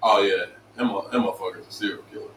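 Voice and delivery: low quick under-the-breath mutter; muttered aside. Retold or imitated. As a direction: mutter it low and fast to a friend in line. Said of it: "you'll be like".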